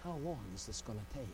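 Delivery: low, soft, rushed murmur to heaven; preacher quietly asking God, hushed and hurried; hushed quick question. Acted. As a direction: ask it under your breath, fast. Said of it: "a preacher looking up to heaven".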